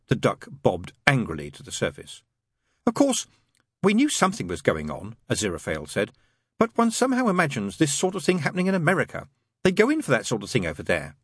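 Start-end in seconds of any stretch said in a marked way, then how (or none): 2.9-11.2 angel character, pitch leaping on openings; lightly voiced angel. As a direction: lightly play a fussy, proper angel.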